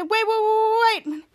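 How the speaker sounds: high, stretched-out pleading call; drawn-out panicky plea; acted alarm. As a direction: call after someone in mock panic. Calling after the jumper.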